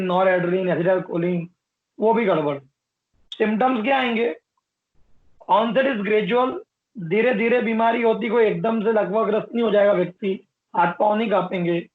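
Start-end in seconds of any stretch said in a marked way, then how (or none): 5.5-6.6 slow, deliberate note reading; slow dictation. Shown as a demonstration. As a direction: read slowly for notes. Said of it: "dictating notes".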